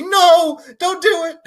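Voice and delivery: shrill high pleading yell; high panicked shout. Retold or imitated. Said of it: "then Stan's like".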